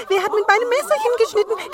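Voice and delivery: sehr hoch, laut und schnell; vierter Zwerg. A narrator's reading. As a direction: aufgeregt hoch losplappern. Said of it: "Der sechste". (hohe, laute Zwergenstimme)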